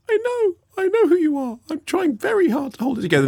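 high, squeaky starstruck gush, fast at the end; starstruck fan. Retold or imitated. Meeting Saoirse Ronan, the host goes high and squeaky in starstruck panic. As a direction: play the flustered fan barely holding it together. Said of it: "And it was like".

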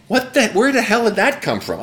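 high-pitched, incredulous outburst; exasperated. Real, not acted. Former player ranting about hand slaps, exasperated. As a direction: demand it, pitch jumping up. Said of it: "it drove him crazy".

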